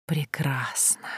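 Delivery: slow, drawn-out whisper; hushed, satisfied sinister whisper. A narrator's reading. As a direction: whisper it slowly, savouring the word. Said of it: "прошептал мужчина".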